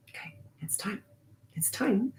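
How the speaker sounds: hushed, very soft, calm and kind; barely voiced tender nudge; gentle wise woman. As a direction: softly coax like a wise grandmother. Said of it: "grandmotherly crone, wise woman ways".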